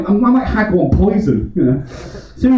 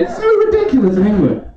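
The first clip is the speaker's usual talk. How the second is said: ordinary talk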